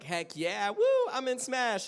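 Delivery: whooping, high and loud; excited celebration. Retold or imitated. Actor reliving the casting announcement, thrilled. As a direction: re-enact a thrilled outburst with a big woo. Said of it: "what was your first initial reaction".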